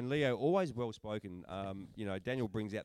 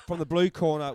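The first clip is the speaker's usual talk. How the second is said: higher, much louder ring-announcer call; fight announcer call; 7 semitones higher, 13 dB louder than usual. Acted. Host introduces the next bout to viewers, ring announcer style. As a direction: boom it out like calling a fighter in.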